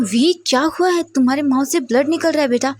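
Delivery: alarmed mother. A narrator's reading. Mother shocked at bleeding son.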